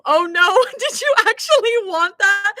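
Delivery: high, loud "oh no" breaking into a laugh; mock horror at a friend's news; dismayed thought. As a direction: blurt out alarm, half laughing. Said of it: "My mind automatically goes to".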